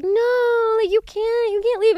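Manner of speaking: high, loud whiny protest; mock protesting friends. Friends at the bar beg her not to leave, high whiny protest. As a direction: whine like a friend who won't let you go.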